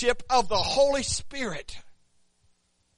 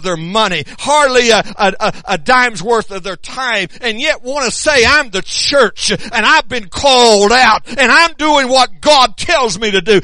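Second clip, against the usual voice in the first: loud, hammering preacher's cadence; preaching at full force; 2 semitones higher, 10 dB louder than usual. Acted. Preacher rousing the congregation about proclaiming God's word, loud fervent preaching. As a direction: drive each line home to the pews.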